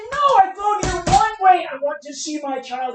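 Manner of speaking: loud, high, pleading cry to the judge; a loud, high outburst of protest in court; desperate plea. Retold or imitated. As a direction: beg the judge to let you see your child.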